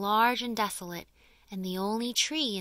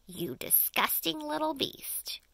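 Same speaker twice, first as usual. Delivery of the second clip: nasty aunt; 7 semitones higher than usual. Acted. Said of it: "referred to him as".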